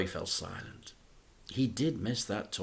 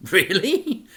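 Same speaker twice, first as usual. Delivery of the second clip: chuckling; 9 semitones higher, 9 dB louder than usual. A narrator's reading. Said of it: "asked Dad with a chuckle".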